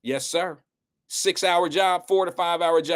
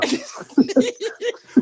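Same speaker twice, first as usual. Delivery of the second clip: high laugh. Real, not acted. Reacting to caller.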